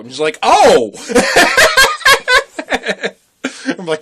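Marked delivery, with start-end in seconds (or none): 0.4-3.8 "Oh!" then high laughter; surprised laugh. Retold or imitated. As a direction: burst out laughing in realization. Clerk realizes, laughs.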